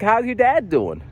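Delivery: high-pitched caseworker question; Chloe. Retold or imitated. Caseworker asking Matt about his dad, high imitation. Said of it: "and she's like".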